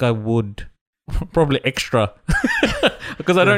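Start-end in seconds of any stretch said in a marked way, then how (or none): 2.2-3.2 real high-pitched laugh. Real, not acted. Admitting he treated her probably extra, real high laugh. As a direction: burst out in a short, high laugh. Laughing at own awkward story.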